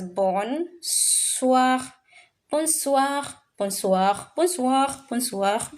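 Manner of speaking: bonsoir said bon-swa, oi as wa; French bonsoir. Shown as a demonstration. Teacher drilling bonsoir for the class, sing-song. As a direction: chant bonsoir, with the pitch going up and down. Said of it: "This is how we say good evening".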